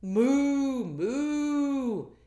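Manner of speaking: loud, high mooing cow; the cow answers with a loud moo. Acted. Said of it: "And the cow said".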